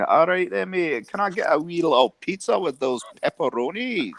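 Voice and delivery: high, far-off mock Scottish voice; prank-call a takeaway in a put-on Scottish accent. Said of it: "I always used to do Scottish".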